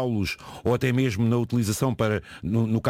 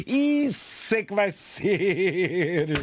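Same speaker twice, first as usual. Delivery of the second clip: laughing; 4 semitones higher, much slower than usual. Real, not acted. Joking about AI taking over.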